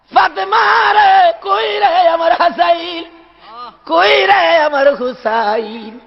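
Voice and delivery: high, slow chanted lament; acted crying call. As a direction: wail for the missing grandsons, drawing out each name. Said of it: "আমার নবী কান্দে". (কান্নার সুরে টেনে টেনে ডাক)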